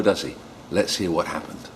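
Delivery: plain reading voice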